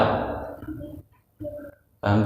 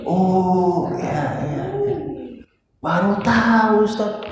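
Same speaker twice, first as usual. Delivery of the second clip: high, drawn-out mock wonder; over-the-top amazement; 10 semitones higher, slower than usual. Shown as a demonstration. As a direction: overact hearing it for the very first time. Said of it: "Cuman jangan lebay juga".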